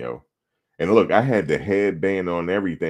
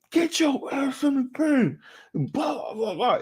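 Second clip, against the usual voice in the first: mock coach screaming; slower than usual. Coach screaming at player at halftime, high mock scream. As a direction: screech it like a raging coach. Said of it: "he's screaming at me".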